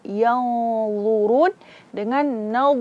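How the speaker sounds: slow "Yanzurun dengan nau", the dha from the tongue edge; distinct heavy dha. Shown as a demonstration. Teacher contrasts the two letters for the class, slow. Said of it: "Maka harus beda".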